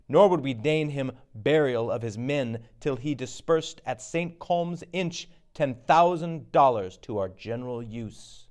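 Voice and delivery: ordinary talk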